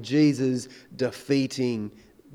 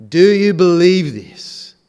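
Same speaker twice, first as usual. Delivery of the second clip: loud searching question; 3 semitones higher, 11 dB louder, slower than usual. Acted. Preacher challenges congregation.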